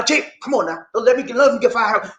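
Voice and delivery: ordinary talk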